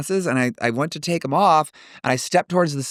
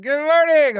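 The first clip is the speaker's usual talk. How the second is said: put-on high, loopy old-man greeting; unhinged old man; 4 dB louder, slower than usual. Wheelchair man greets woman walking by, unhinged high greeting. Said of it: "obviously off his fucking rocker".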